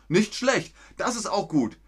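high, bright praise for a good answer; excited praise. Real, not acted. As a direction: cheer the student on, pitched well up. Praising a viewer's sentence.

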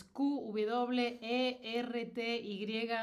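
Q W E R T Y letter by letter, slowly; spelling out. Shown as a demonstration. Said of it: "esas son las letras".

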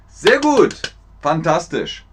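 usual speaking voice

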